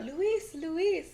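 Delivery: high-pitched call without real volume; mock calling out. Sister calls out to her little brother, as a high mild mock yell. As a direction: imitate a yell, high but held back. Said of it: "like, yelling out".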